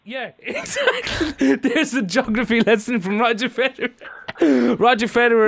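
high-pitched, laughing through the words. Real, not acted. Host amused by his own prank caller, laughing. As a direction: barely hold the laughter in.

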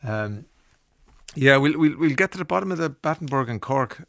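ordinary talk, nothing marked

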